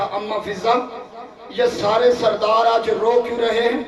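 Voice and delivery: a Shia preacher's climbing lament; rising majlis recitation. Acted. Preacher recounting the mourning to the majlis, mournful rising chant. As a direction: build the grief steadily, pitch rising.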